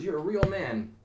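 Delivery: lightly voiced astonished woman; amazed woman. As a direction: read it like the punchline in awe. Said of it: "and she goes".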